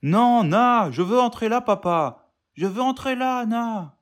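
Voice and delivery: put-on high, whining little boy; whiny child voice. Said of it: "une petite voix pleurnicharde".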